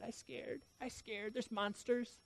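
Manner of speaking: put-on high, tiny voice of a frightened four-year-old; scared little child. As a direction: imitate a small child whimpering softly about fear. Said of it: "She said".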